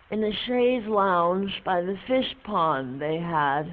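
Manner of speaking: ordinary talk, nothing marked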